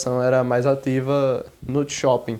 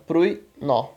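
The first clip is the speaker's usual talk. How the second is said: "pruino" as prui, no; "prui... no", pausing between syllables; separated syllables. Shown as a demonstration. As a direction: split "pruino" into two syllables with a gap. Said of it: "Ele vai ser separado".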